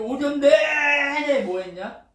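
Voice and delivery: high, very loud, slow stretch; drawn-out shout. Real, not acted. Preacher asks what the prophets did all morning, in a stretched shout. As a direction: belt it out, dragging the words.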